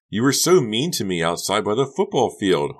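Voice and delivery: loud hurt cry; exclaimed. Acted. Honey reproaches Becca, loud and hurt. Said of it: "Honey exclaimed".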